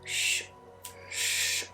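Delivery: usual speaking voice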